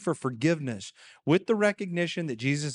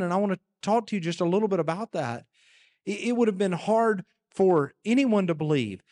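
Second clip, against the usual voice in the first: ordinary delivery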